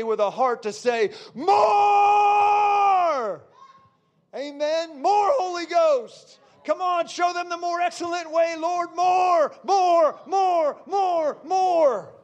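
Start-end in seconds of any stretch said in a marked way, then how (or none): 1.3-3.4 very high, long-held revival cry; impassioned preacher's yell, stretched long; climactic shout. Acted. Climax, cry of a willing heart. 4.4-12.1 high, ringing plea to God; impassioned preacher calling down the Spirit; fervent shouted prayer. Real, not acted.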